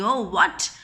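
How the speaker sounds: ordinary delivery, nothing marked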